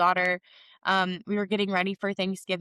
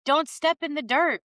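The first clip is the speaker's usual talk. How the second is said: put-on toddler warning; 7 semitones higher than usual. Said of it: "she's like".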